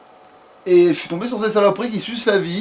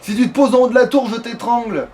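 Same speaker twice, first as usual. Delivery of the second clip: exasperated threat; 5 semitones higher than usual. Real, not acted. Player threatening the dragon over the tower, exasperated threat. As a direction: throw the threat up at it, raised pitch.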